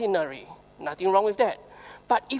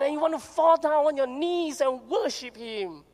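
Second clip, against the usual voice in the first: usual speaking voice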